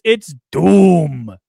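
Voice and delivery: loud, drawn-out villain announcement; mock-grand, booming villain voice; Doom. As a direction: boom the name out like a hammy villain. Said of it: "Joel Jimenez says".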